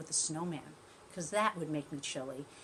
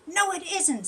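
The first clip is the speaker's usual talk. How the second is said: tortoise protesting; 10 semitones higher, 9 dB louder than usual. Acted. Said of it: "said Shelley".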